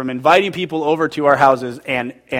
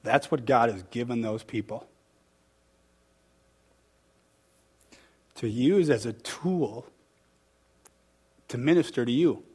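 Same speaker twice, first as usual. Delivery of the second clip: emotional, moved; 3 semitones lower, 6 dB quieter than usual. Real, not acted. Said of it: "why I'm getting emotional".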